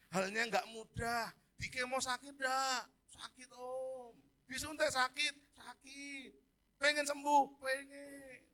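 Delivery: put-on rally a group of kids and echo their replies; calling to children. (berseru tinggi pada anak-anak)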